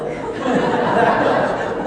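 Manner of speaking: loud breathy laugh; amused burst of laughter. Real, not acted. Laughing at own story.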